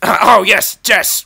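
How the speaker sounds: laughing, high and loud; gleeful announcement. Real, not acted. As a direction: burst out laughing into it.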